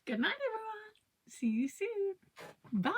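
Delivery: high, singsong goodbye; cheery goodbye. Real, not acted. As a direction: sing out the goodbye. Cheery sign-off.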